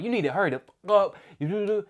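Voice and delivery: half-strength retold holler; mock yell. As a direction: mimic her nagging, dissolving into babble. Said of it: "she yelling".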